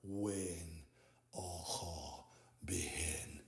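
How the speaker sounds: hushed voiceless whisper. Acted. Ending the incantation, faint whisper. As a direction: breathe the last words out.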